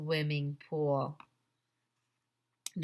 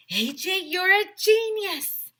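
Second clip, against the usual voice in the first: higher, louder, slow and drawn out; higher and louder gushing, slow; excited praise; 13 semitones higher, 8 dB louder, slower than usual. A narrator's reading. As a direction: gush like the plan is brilliant.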